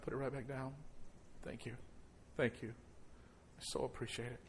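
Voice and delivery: soft, intimate pastoral voice; quiet invitation to respond; hushed altar call. Acted. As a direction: speak gently to bowed heads. Quiet altar call, heads bowed.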